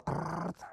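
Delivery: long, drawn-out trilled gush; gushing tap noise. Retold or imitated. Patient describing his nosebleed, imitated gushing noise. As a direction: imitate blood running like tap water. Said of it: "kayak keran air tuh".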